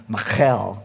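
"Michal" with a throaty Hebrew kh; "Michal" in a put-on Hebrew accent; Hebrew pronunciation. Shown as a demonstration. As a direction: say "Michal" as mi-KHAL, stressed at the end. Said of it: "say it with a Hebrew accent".

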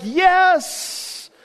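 high, drawn-out cheering yes; mock excitement. Preacher as romantics rooting for Ruth and Boaz, excited. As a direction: celebrate like a thrilled romantic. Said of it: "we're excited".

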